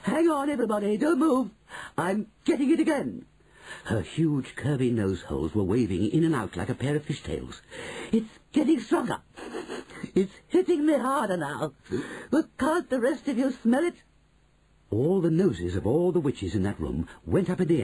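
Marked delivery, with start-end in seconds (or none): 0.0-3.2 high, urgent witch; crying out. Acted. Mildred halts the witches, high urgent cry. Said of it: "cried the witch called Mildred". 8.1-13.9 high, mounting excitement; urgent witch. Acted. Mildred appeals to the others, high and urgent.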